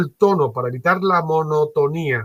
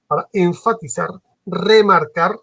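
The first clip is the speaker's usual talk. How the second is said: "remarcar" punched louder and higher; emphasis; 4 semitones higher, 3 dB louder than usual. Shown as a demonstration. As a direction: lean hard on "enfatizar" and "remarcar". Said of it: "fijaros que lo estoy haciendo".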